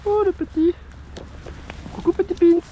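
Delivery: high cooing voice to a little fish. Real, not acted. Greeting a small fish.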